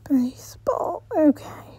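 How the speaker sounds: soft unvoiced whisper; sheepish whisper to herself. Real, not acted. Embarrassed whispered self-correction.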